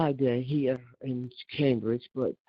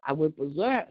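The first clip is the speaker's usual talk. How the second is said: ordinary delivery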